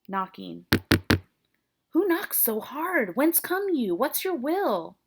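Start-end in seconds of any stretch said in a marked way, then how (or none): 1.9-5.0 high, drawn-out acted call; calling through door. Friar questioning an unknown knocker, high insistent call. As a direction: demand to know who is out there.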